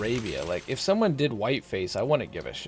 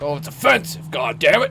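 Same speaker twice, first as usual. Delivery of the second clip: high, loud mocking of an offended critic; mock outrage; 4 semitones higher, 7 dB louder than usual. S0 mocking people offended by the costume, loud mock outrage. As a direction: ham up the outrage of a touchy critic.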